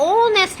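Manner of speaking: "ऑनेस्ट" starting on a stretched O; silent H. Shown as a demonstration. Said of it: "हम ह तो नहीं लिख रहे". ("ऑनेस्ट" में ह नहीं, लंबा ओ)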